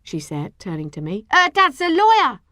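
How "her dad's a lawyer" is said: high, loud boast; crowing brag; proud announcement. A narrator's reading. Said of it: "she announced proudly".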